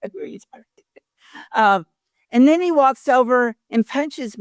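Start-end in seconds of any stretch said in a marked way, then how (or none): 0.1-1.6 whispered aside. Retold or imitated. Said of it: "And I'm going".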